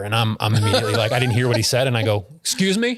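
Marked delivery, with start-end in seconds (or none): 2.4-3.0 sharp, high defensive snap. Retold or imitated. Answering the friendly neighbor, defensive. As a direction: bristle at him. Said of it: "Like, immediately defensive".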